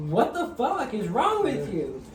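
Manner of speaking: high, drawn-out retold demand; girlfriend's retort. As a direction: girlfriend challenging his outburst, exasperated retort. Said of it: "my girlfriend's like".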